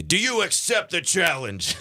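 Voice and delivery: high-pitched, hammed-up dare; showy wrestler-style challenge; dramatic challenge. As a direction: throw down the gauntlet theatrically. Wrestling-style dare after the impersonation.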